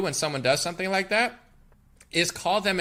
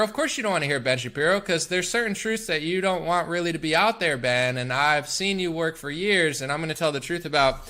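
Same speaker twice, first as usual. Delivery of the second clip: ordinary talk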